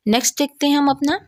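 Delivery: ordinary delivery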